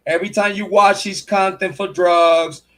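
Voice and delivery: ordinary talk, nothing marked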